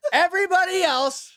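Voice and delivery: high, slow barked order; a high, drawn-out command; mock command. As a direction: order the room with mock authority. Brothers ordering push-ups.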